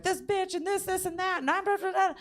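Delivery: high-pitched mocking rant; mock freakout. Mimicking the freaking-out friend, high mock rant. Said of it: "She was like".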